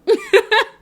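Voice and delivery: high, loud burst of laughter; real laugh. Real, not acted. Laughs off her near tears.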